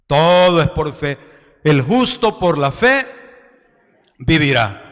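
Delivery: loud, ringing pulpit delivery; full preaching. Acted. Pastor preaching faith to his church, loudly.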